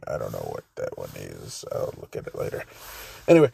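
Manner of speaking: ordinary delivery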